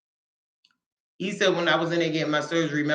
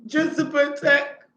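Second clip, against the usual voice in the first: voice rising with emotion; 11 semitones higher, 4 dB louder, slower than usual. Real, not acted. Moved by husband's sacrifice.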